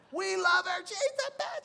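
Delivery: very high squealed adoration; mock screaming fangirls. Preacher imitating daughters at Hannah Montana's show, shrieky fangirl falsetto. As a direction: squeal it like a preteen fan. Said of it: "think about what they're doing".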